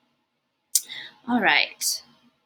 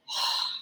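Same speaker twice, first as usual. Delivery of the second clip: slow breathy monster pant; heavy monster breath; 9 dB quieter than usual. Acted. Herbert panting to show he is a mouth breather, heavy breath.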